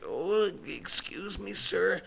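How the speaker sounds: high, slow, soft mock American woman; over-the-top American. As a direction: imitate an over-polite American shopper, drawn out. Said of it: "Americans are famous for being over the top".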